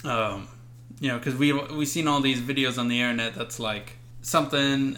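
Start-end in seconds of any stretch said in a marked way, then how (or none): none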